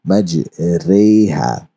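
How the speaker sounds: मजरेहा said very slowly with bent e vowel; tilted vowel in मजरेहा; imala e sound. Shown as a demonstration. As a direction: draw out मजरेहा, the re between ra and ri. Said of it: "टेढ़ा करके जो है पढ़ा जाता है".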